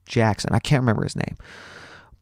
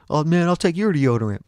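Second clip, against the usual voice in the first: joking classmate; 6 semitones higher than usual. Retold or imitated. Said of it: "he started making jokes like".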